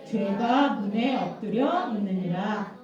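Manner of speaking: slow, measured Bible recitation; reading aloud together. Real, not acted. Pastor leads children in the memory verse, slow recitation. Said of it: "달달 말씀인데 같이 한번 읽어봐요".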